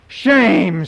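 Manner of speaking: loud indignant shout. A narrator's reading. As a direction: shout shame at him. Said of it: "somebody shouted".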